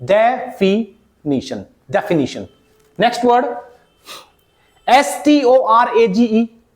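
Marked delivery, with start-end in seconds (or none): 0.1-1.6 definition very slowly broken up as de - fi - ni-shun; syllable by syllable. Shown as a demonstration. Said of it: "कैसे pronounce करोगे". 2.0-2.4 definition said as de-fi-NI-shun, falling; correct pronunciation. Shown as a demonstration. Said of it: "कैसे pronounce करोगे".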